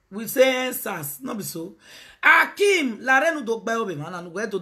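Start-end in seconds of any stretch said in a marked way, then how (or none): none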